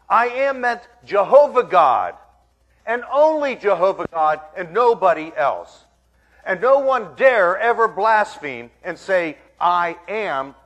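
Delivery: loud, emphatic pulpit declaration; forceful preaching. Real, not acted. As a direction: preach it hard, punching the key words.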